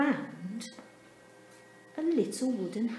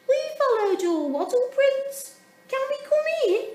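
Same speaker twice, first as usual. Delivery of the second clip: very high, louder little penguin voices; little sisters; 7 dB louder than usual. A narrator's reading. Sisters knock at Dudley's door, very high. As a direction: chirp it up high like small sisters.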